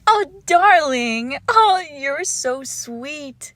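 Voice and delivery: loud, high squeal of affection easing down; swooning, high-pitched delight; delighted gushing. Acted. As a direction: gush over a sweetheart, overjoyed. Delighted by the pet's answer.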